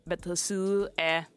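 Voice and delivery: ordinary delivery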